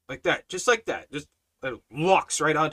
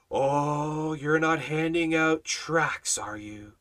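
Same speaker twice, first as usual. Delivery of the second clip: really creepy; much slower than usual. Retold or imitated. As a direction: imitate her creepily, drawing out the words. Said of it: "Just like that. Really creepy".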